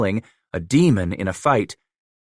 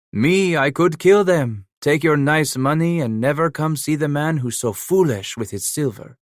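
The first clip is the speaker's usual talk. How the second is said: French-accented Emile, mocking; 7 semitones higher than usual. A narrator's reading. Trapper Emile mocking Leclerc's runners, lightly French-accented. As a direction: needle him, half laughing in a French accent.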